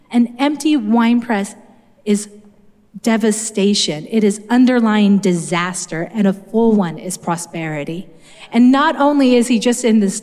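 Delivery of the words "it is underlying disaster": normal talking voice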